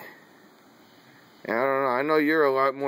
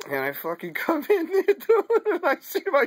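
real laughter pushing the voice high; laughing; 13 semitones higher than usual. Real, not acted. Telling of visiting dying grandma, laughing through it. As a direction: crack up while telling the story.

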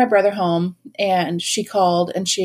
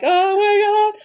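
high, drawn-out mock wail; play-acted hysterical sob; mock crying; 13 semitones higher, 4 dB louder, slower than usual. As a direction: wail it like panicked teenagers, hammed up. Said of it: "crying, holding the dogs".

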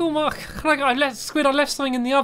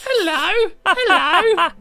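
squeaky high voice; 10 semitones higher, 5 dB louder, much slower than usual. Acted. Greeting a dog in-game.

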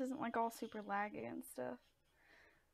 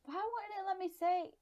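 loud, high complaint; high-voiced exasperation; frustrated protest; 9 semitones higher, 9 dB louder than usual. Real, not acted. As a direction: cry out in baffled frustration. Game blocks her input.

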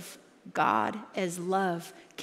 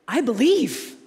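desperate father; 3 semitones higher, 7 dB louder, slower than usual. Retold or imitated. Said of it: "he goes".

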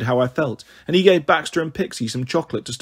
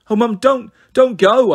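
high, raised plea, don't go; pleading; 8 semitones higher, 4 dB louder than usual. Acted. Girl begging her mum not to be taken away, high pleading. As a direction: beg her to stay, voice climbing. Daughter begs mother not go.

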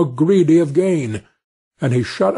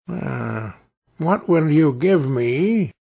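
gravelly sly hobgoblin; slower than usual. A narrator's reading. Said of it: "said the hobgoblin".

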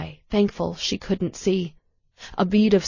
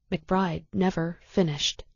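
plain reading voice

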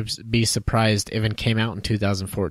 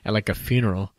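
normal talking voice

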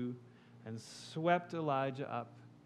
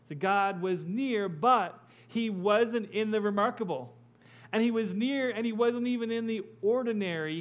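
loud, rising sermon build; full-force preaching; 8 semitones higher, 8 dB louder than usual. Acted. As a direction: drive it home to the congregation.

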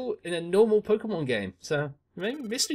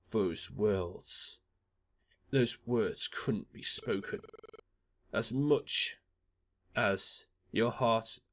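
much lower, grave and mournful; deep-voiced companion; 9 semitones lower, 5 dB quieter than usual. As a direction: speak slowly of the one who left. Deep voice consoling Blaze.

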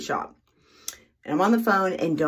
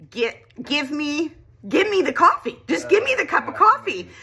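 exasperated demand; 8 semitones higher than usual. Retold or imitated. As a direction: build from clipped to near-shout. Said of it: "I said".

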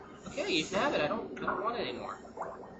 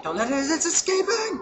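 loud, high shout to stop it; urgent shout; 8 semitones higher, 9 dB louder than usual. Real, not acted. Gamer shouting at the fleeing prey, urgent yell. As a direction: yell it as the prey slips away. Prey getting away in game.

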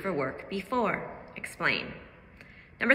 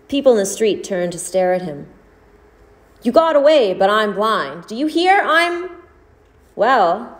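expressive read-aloud, louder than her chat; story narration; 11 dB louder than usual. Acted. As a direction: read the story to the class with drama. Teacher reads short story aloud.

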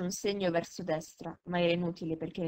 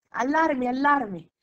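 high, loud sentry's cry; shouted alarm; 6 semitones higher, 9 dB louder, slower than usual. A narrator's reading. Nearby sentry spotting the dark shape, shouting the alarm. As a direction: shout the warning out to the garrison. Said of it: "gridò in quel momento una sentinella vicina".

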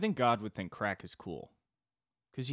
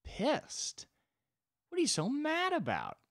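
very high mock indignation; baffled God; slower than usual. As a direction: squeak it out, baffled by their anger. God baffled by angry humans.

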